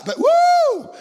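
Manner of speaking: high, loud whoop of delight; joyful whoop. Pretend. Answering the enemy's accusation with a loud whoop. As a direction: let out a whoop of joy.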